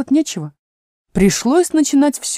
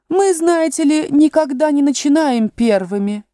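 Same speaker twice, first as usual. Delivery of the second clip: high, louder haughty Rose voice; Rose butting in; 8 semitones higher, 5 dB louder than usual. A narrator's reading. The Rose interrupting Alice and the lily. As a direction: cut in with a high, loud voice. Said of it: "вмешалась Роза".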